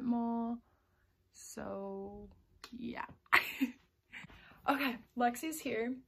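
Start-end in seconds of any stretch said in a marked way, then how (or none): none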